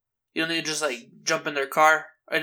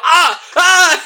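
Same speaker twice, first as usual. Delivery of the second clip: high, louder mock fish cries through laughter; fish screaming; 10 semitones higher, 6 dB louder than usual. Voicing the fish as Bear punches it, high and louder. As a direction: squeal like a fish being beaten. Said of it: "The fish like".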